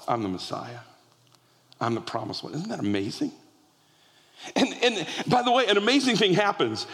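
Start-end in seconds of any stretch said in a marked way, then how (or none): 0.1-2.5 softer and lower, hushed and intimate; a quiet, awed confession; hushed reverence. Acted. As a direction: lower the voice to let the reveal land. Hushed retelling of revelation.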